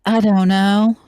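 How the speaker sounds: high, loud Southern belle voice. Acted. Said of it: "I made Rouge a Southern Belle".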